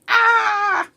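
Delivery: loud, high-pitched play scream; mock terrified scream. Voicing the rubber bat's scared face, with a mock terrified scream. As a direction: scream as a terrified toy bat would. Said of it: "He looks terrified. He's like".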